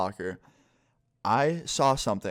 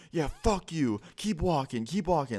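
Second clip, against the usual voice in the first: higher mocking taunt; taunting boyfriend; 6 semitones higher, slower than usual. Boyfriend taunting me as I walk away, pitched high. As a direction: jeer at him in a higher voice.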